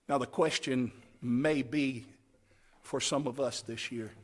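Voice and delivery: ordinary talk, nothing marked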